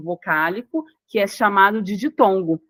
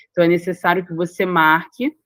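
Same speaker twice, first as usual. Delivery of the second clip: usual speaking voice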